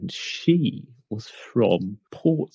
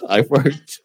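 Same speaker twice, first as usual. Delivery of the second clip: laughing refusal; 5 semitones higher, 3 dB louder than usual. Real, not acted. Refuses to do the accent.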